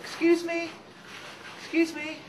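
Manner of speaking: very high, tiny squeak; little voice. Acted. Snail calls from the doorstep, tiny high voice. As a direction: pipe up like something minuscule. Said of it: "he hears this little voice saying".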